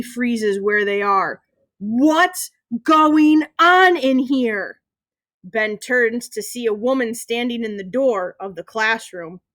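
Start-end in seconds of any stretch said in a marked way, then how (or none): 1.8-4.8 slow, loud, high teacher's yell; yelled demand. A narrator's reading. Teacher finds the class in chaos, yelling. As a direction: stretch each word out commandingly. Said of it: "another voice yells out".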